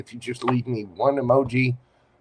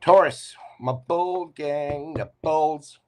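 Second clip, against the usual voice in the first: calling out; 5 semitones higher, slower than usual. Real, not acted. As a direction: hail the sign like a host. Calling out the sign.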